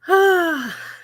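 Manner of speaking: loud, high sigh falling away; heavy sigh. Real, not acted. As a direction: let out a big audible sigh. Exasperated reaction after the laughing.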